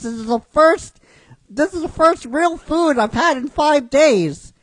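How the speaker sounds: worked-up, high-pitched griping; Pikachu. Acted. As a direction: protest indignantly about being starved. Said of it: "Pikachu begins to talk".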